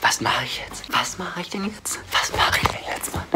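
soft, frantic scared whisper; panicked whisper. Acted. Panicked whispering after the shock.

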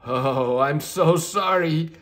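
a flustered little unicorn saying sorry; lightly voiced young unicorn. Unicorn apologises to starfish.

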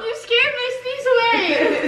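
high, laughing complaint; playful outrage. Real, not acted. Accusing friend over a lost sneeze, playfully outraged. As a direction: protest in a high voice through a laugh.

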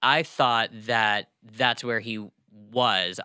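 ordinary talk, nothing marked

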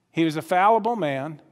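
usual speaking voice

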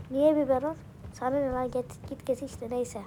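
ordinary delivery

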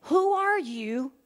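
lightly voiced bewildered Saul; Saul asking. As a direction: Saul crying out to the voice, startled. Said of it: "was when he said".

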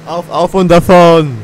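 loud, slow rallying cry; triumphant shout. Real, not acted. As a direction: belt it out as you set off. Triumphant call setting off.

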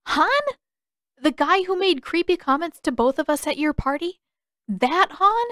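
stunned, can't believe her ears; shocked disbelief. Acted. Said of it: "Katara felt her eyes bulge".

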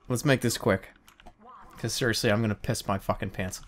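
low, soft confession; low serious aside. Real, not acted. Gamer desperate to pee mid-game, low serious aside. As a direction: drop your voice, dead serious.

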